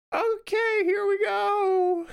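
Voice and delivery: put-on very high, drawn-out girl voice; hammed-up high American girl. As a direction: stretch it out in a squeaky-high girl voice. Skit ends in girl voice.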